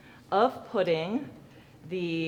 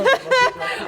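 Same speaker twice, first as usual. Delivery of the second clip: laughing; 7 dB louder than usual. Real, not acted. Laughing at own mistake.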